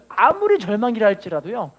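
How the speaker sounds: normal talking voice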